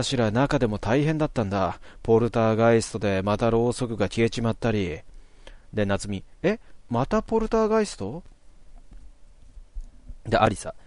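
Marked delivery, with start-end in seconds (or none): 6.4-8.2 a sharp high rise of alarm; alarmed question. Acted. Natsumi hearing of another poltergeist, high and alarmed. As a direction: ask it high, startled all over again. Voicing Natsumi in game text.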